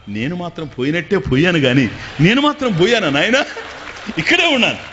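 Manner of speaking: loud, laughing gotcha; a sly sage laughing as he reveals he never left. Acted. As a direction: chuckle it out triumphantly. Saint pops up laughing.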